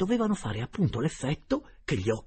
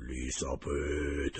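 lower, very slow solemn ghost; grave voice; 5 semitones lower, much slower than usual. A narrator's reading. Boy as the uncle's spirit, grave slow ghost voice. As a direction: pronounce it like a verdict. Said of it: "risposi con voce grave".

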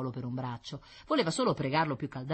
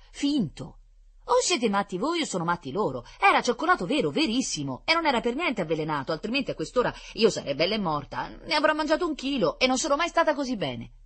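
put-on indignant little girl; 9 semitones higher, 7 dB louder than usual.

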